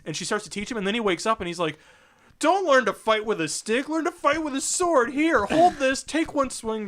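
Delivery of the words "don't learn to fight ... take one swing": lightly voiced drunken instructor, pitched up; hint of a boisterous drunk giving orders; drunk Conan. Retelling Conan's sword lesson, lightly acted drunk.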